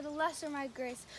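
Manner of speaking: ordinary talk, nothing marked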